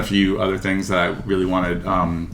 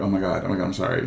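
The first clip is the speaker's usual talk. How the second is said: soft, groggy apology; sheepish apology; 3 semitones lower, 9 dB quieter, faster than usual. Acted. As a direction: apologise softly as if just woken. Apologising after being woken.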